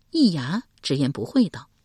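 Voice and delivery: plain reading voice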